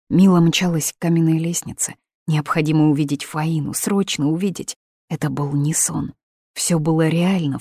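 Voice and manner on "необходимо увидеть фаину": ordinary delivery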